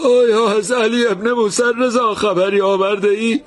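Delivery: a grieving man's high, choked plea. A narrator's reading. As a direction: ask through a lump in the throat. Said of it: "مرد با بغض گفت".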